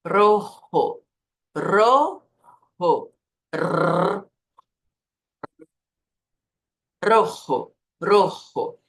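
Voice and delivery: emphatic rojo, rolled R and throaty j; trill and throat sound. Shown as a demonstration. Teacher demonstrating the mechanism, emphatic trilled R. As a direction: exaggerate both sounds in rojo, trilling rrr. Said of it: "Faz exatamente esses sons".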